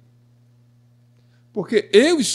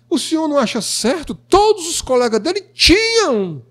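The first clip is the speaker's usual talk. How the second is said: higher and louder, climbing to near shouting; the mother defending herself, her voice rising more and more; a defensive mother, pitched up and pleading; voicing the indignant mother; 9 semitones higher, 5 dB louder than usual. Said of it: "Ela disse".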